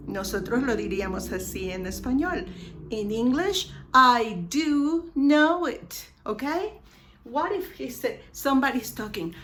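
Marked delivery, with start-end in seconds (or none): 3.9-6.0 slow, raised, louder, long DO; emphatic DO. Shown as a demonstration. Teacher gives the English version, DO slow, high and loud. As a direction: stretch DO with firm insistence. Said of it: "to make an emphatic affirmation".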